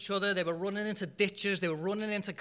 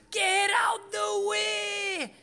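high, slow, hammed-up English country accent shout; shepherd's accent; 3 dB louder, much slower than usual. Imitating the English shepherd's accent for the congregation, high slow shout. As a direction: yell it like the angry English shepherd again. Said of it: "That was his accent".

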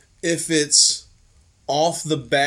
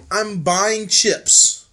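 slow deliberate; 4 semitones higher, 4 dB louder, much slower than usual. Retold or imitated. Speaker quotes himself, deliberate.

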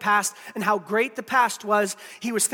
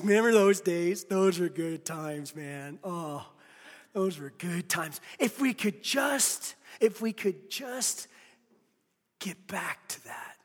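put-on slow, softer longing for old times; wistful reminiscing; 4 semitones lower, 6 dB quieter, slower than usual. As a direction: drift into a fond memory, slowly. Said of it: "you're just like".

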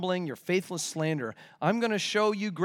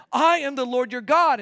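ringing proclamation; 6 semitones higher, 7 dB louder than usual. Acted. Climactic proclamation.

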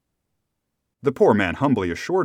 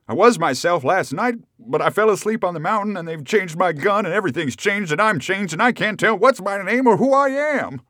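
frantic, high and building; at wits' end; 9 semitones higher, 5 dB louder than usual. A narrator's reading. Rip no longer knows who he is, frantic confusion. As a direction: get louder and higher as you unravel. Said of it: "at his wits' end".

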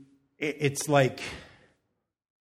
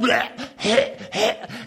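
loud, high burst of laughter; 8 semitones higher, 5 dB louder than usual. Real, not acted. Mom laughing while retelling daughter's tantrum, loud laugh. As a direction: laugh out at the memory. Laughing at her own story.

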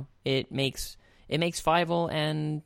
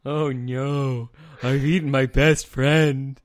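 big dopey cat voice, loud and slow; Tiger's voice; 7 dB louder, slower than usual. Acted. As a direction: boom it out like a dim cartoon cat. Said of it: "It's fun to do Tiger's voice".

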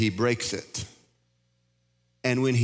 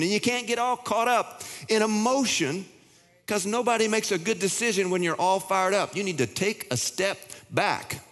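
higher, intense preaching push; fired-up preaching; 7 semitones higher than usual. Acted. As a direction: preach it hard to the congregation. Preaching builds to full force.

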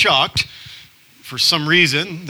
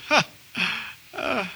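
awkward laugh; 8 semitones higher, 7 dB quieter than usual. Retold or imitated. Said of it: "one guy in the back that goes".

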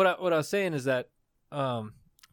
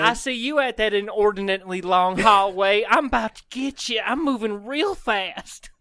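high southern twang, drawled out at the end; mock menace in a hammed-up Texas accent; Phantom Bell man; 5 semitones higher, 5 dB louder than usual. Ghost bellman spotting prey down a hallway, in a southern accent.

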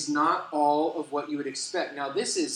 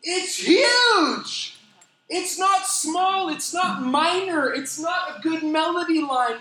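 high, loud, slow preaching cry; impassioned shout; 14 semitones higher, 7 dB louder, much slower than usual. Real, not acted. Preacher urging the congregation, loud impassioned cry. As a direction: cry it out from the pulpit.

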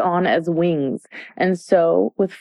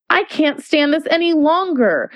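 cry out; 8 semitones higher than usual. A narrator's reading. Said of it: "she cried as she jumped up".

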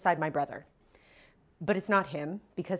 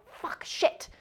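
whispered swearing. Retold or imitated. Dad sold the coat.

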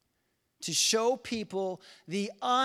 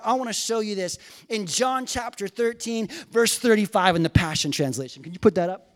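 ordinary talk

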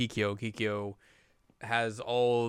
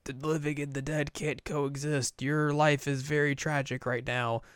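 solemn monk; 4 semitones higher, 4 dB quieter than usual. Retold or imitated. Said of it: "He's like".

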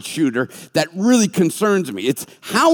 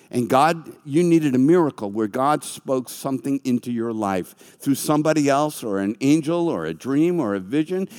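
usual speaking voice

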